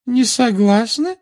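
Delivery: very high, slow teacher's query; icy challenge. A narrator's reading. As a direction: draw the question out in a high voice. Teacher challenges a contradicting pupil. (протяжный, высокий холодный переспрос)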